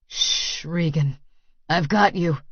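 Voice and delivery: low, softened reassurance; soothing hush. A narrator's reading. Lover soothing frightened partner, low and reassuring.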